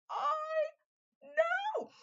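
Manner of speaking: very high, loud "I know"; excited high shout. Retold or imitated. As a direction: shriek it in delighted agreement.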